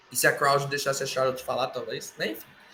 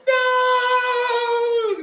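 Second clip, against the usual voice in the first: much higher wailing sung-out no; melodramatic scream; 4 dB louder than usual. Retold or imitated. Heyman screaming as the move lands, hammed-up. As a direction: wail it out like an opera villain. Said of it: "melodramático pra caralho".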